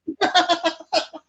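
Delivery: a high squeal of real laughter. Real, not acted. Laughing in high squeals at the caller's age.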